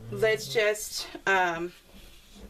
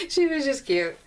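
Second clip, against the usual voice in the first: high giggle, then words through it; laughing; 8 semitones higher than usual. Real, not acted. Daughter laughing about her mom in a cute hat.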